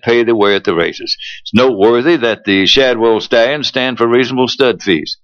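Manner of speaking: radio ad announcer read; polished sponsor spot delivery. Acted. Reads a stallion ad.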